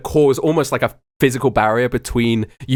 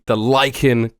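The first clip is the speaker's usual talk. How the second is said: usual speaking voice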